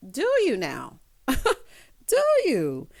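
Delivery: mocking, sceptical retort; sassy disbelieving jab; sarcastic doubt. Real, not acted. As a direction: needle her with sarcasm. Mocking Rena's claim.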